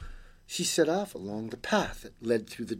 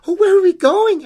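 put-on very high, loud child voice; little girl calling; 13 dB louder than usual. Girl asks creature mid-flight, high loud call.

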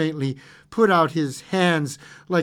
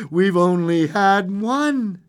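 loud, slow, raised emphasis; eager insistence; 5 semitones higher, 6 dB louder, slower than usual. A narrator's reading. Wife insists wishes are left, eagerly. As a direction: draw it out in excitement. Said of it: "she replied rapidly".

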